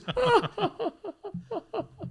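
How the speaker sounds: high giggly laugh, re-enacted; high mock laughter; imitated laughing. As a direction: act out being caught mid-laugh. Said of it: "we're going like this".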